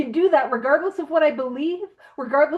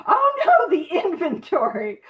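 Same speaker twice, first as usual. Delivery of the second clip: mock dismay; 4 semitones higher, 4 dB louder than usual. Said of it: "vigorously typing in the chat".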